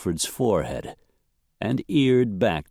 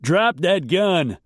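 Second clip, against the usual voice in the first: high, forceful slow shout; yelled order; 8 semitones higher, 4 dB louder, slower than usual. A narrator's reading. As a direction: yell a command over the noise. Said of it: "a man yelled".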